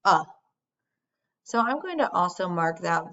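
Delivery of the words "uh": "uh" said as a short clear vowel; short vowel demo. Shown as a demonstration. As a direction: isolate the short "uh" sound. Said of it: "the short vowel sound uh".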